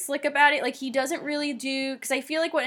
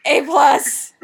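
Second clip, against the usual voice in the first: enthusiastic judge; 4 semitones higher, 9 dB louder, slower than usual. Pretend. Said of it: "I'd be like".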